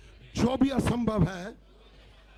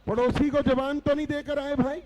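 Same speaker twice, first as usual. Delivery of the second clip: higher and louder fervent preaching; preaching at full force; 5 semitones higher, 3 dB louder, slower than usual. Acted. Pastor leading the church to chant without fear, fervent preaching. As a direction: rouse the congregation to chant along.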